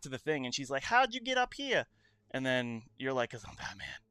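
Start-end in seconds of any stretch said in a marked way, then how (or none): 0.8-1.9 Harley Quinn voice. Retold or imitated. 3.3-4.0 low, whispered Batman growl, much softer. Retold or imitated. Answering Harley in a low whispered Batman voice. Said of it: "first time I used the Batman voice".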